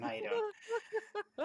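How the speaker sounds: drawn-out high mock greeting; teasing sing-song greeting. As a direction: greet him in a slow, high teasing croon. Teasing greeting to stoic character.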